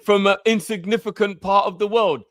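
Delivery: high, loud put-on snob voice looking down on them; high mock-snob voice sneering at ethnic youths; mocking the establishment. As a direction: scoff like a gatekeeper dismissing nobodies. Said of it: "That's how they look on us".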